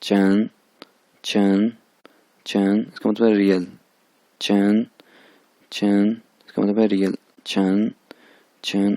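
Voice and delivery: first tone चैन drill. Shown as a demonstration. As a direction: sing चैन flat and steady. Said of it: "first one है चैन".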